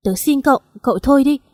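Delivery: pleading cry. A narrator's reading. Daughter cries out begging her friend to stop. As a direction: beg him to stop, raising the voice. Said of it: "tôi lại gào lên".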